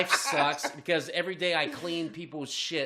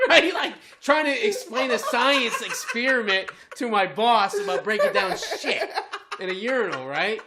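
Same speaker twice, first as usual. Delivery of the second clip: laughing, louder and higher; laughing loudly; 5 semitones higher, 8 dB louder than usual. Real, not acted. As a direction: crack up while explaining. Laughing at own story.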